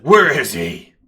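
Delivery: very loud detective's shout; booming interrogation from a driven detective; demanding detective. Acted. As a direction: demand it at full volume. Detective storms up, demands suspect.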